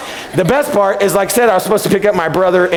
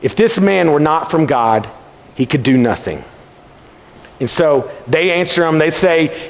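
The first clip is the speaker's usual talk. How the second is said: normal talking voice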